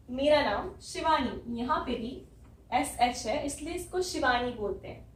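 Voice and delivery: normal talking voice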